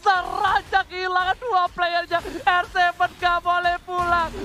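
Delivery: loud, drawn-out esports call; hyped play-by-play. Acted. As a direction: call the fight with rising hype. Hype casting of teamfight.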